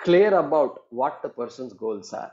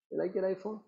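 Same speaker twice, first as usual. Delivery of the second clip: soft repeat; 6 semitones higher, 10 dB quieter, much faster than usual. Retold or imitated. Sage repeats the question softly to his wife. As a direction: drop to a quiet follow-up. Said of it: "And he says".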